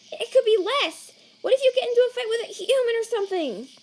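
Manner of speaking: high, loud, panicked telling-off; frantic scolding. Acted. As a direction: scold them at a high pitch out of sheer worry. Alarmed scolding of Sans.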